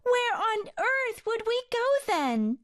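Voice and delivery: high little bird voice, pleading; pleading plover. Acted. Plover begs the developers not to flatten the nest, high pleading bird voice. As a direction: beg like a tiny worried bird. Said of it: "cried the plovers".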